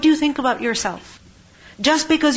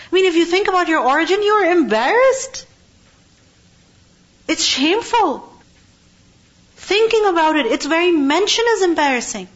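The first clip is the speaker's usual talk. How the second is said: raised, urgent sermon voice, pitched high; impassioned preaching; 5 semitones higher, 4 dB louder than usual. Acted. Preacher urging the congregation against arrogance, fervent and raised. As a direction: plead with the audience to feel ashamed of pride.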